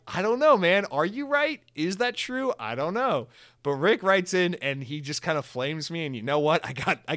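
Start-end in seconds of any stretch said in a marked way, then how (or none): 0.1-3.3 higher, louder, playfully baffled; mock bewildered. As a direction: act lost and unsure of the answer. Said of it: "I'm like".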